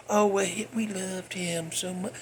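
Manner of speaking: mock sentimental. Mocking the band's fake grief.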